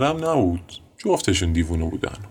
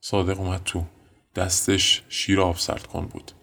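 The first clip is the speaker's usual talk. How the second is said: ordinary delivery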